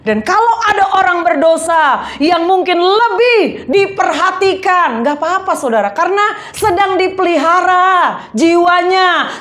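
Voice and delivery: emphatic sermon delivery; preaching. Real, not acted. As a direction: preach it forcefully with punched key words.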